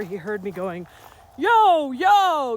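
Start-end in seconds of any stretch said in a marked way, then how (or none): 1.4-2.6 loud call of yo yo, much louder; shouting a warning down the trail; big yelled call. Retold or imitated. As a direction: belt it out to warn the rider. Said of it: "I have a really big voice".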